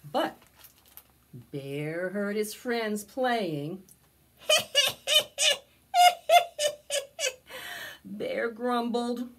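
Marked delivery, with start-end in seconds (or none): 4.5-5.6 squeaky critter giggle; high giggle. Acted. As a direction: giggle like a little raccoon. Friends laughing outside.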